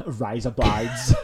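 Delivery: mock Australian vowels; Australian accent. Shown as a demonstration. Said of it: "but that's Australian".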